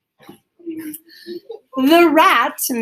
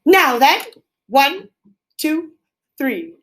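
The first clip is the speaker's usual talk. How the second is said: a loud, raised count-in, starting high; proclaiming; 6 semitones higher, 4 dB louder, slower than usual. Acted. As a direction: announce it like the leader of the carolers.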